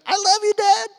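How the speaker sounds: high, loud, bursting with love; sincere joy. Retold or imitated. Excited son thanking dad for the movie. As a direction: gush it with all your heart. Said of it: "the most sincere".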